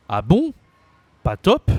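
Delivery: high, loud questioning surprise; acted surprise. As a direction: react as if taken aback, pitch jumping up. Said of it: "when you're surprised".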